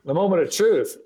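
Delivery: high, playful mock-suspense proclamation; dramatic echo. Guest echoing the co-host's hype, dramatic announcement. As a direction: echo it like a big reveal.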